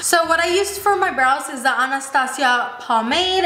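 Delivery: usual speaking voice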